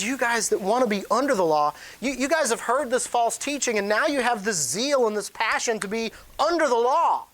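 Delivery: high, emphatic challenge as Paul; heated rebuke. Acted. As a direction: confront them with rising intensity. Voices Paul confronting the Galatians.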